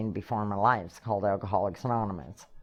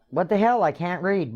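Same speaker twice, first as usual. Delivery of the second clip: mock-angry exasperation; exasperated outburst; 7 semitones higher than usual. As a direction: blurt it out, fed up. Exasperated at dictionary gift.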